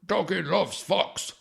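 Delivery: a hammed-up dog speaking through a psychic; dopey dog voice. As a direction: drag out doggy loves fox like a dim pet.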